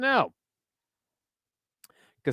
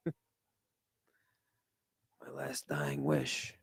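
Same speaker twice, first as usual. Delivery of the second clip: mock dying Don; 15 dB quieter than usual. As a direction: fade out like a dying old man. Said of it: "The Don, he talks like this".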